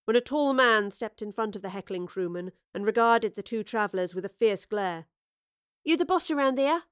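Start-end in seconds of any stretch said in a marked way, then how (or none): 5.8-6.8 demanding. A narrator's reading. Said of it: "Astrid demanded to know".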